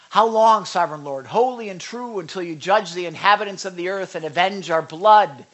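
loud calling cry to the Lord, again pleading. Acted. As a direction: call out loudly to heaven. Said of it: "called out in a loud voice".

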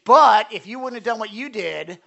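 loud, accusing comeback; mock indignant retort; blaming excuse. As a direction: push the blame back on them. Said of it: "you're responsible for your own sin".

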